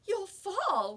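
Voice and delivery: a mother's alarmed warning; anxious mother calling up the ladder; worried. Acted. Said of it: "worried Missus Huggins".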